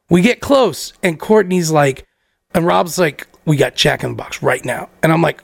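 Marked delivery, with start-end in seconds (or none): 3.4-4.9 put-on low, subdued drunk friend; Rob. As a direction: imitate a wasted buddy's low grumble. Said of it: "Rob wasted".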